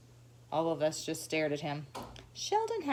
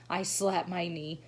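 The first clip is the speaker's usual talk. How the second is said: usual speaking voice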